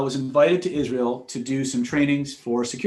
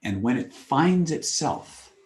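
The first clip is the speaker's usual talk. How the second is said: slow emphasis; 4 semitones lower, slower than usual. Real, not acted. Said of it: "slow closing point".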